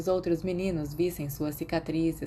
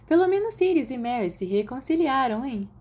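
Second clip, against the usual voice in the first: higher and louder, teasing; lightly voiced girl; 7 semitones higher, 4 dB louder than usual. A narrator's reading. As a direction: nudge him about the couple. Girl teasing about couple.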